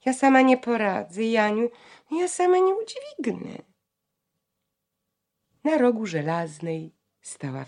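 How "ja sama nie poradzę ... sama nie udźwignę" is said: high pleading whine; whining near tears. A narrator's reading. Bronka whimpering to her sister, high and near tears. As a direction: whimper that you can't manage alone. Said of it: "Bronka, bliska płaczu, jęczała".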